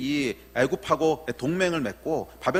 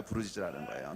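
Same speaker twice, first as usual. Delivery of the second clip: low, much softer restatement; subdued repeat; 8 semitones lower, 10 dB quieter than usual. Real, not acted. Preacher repeats the command to congregation, low and quiet. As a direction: let the command drop to a quiet echo.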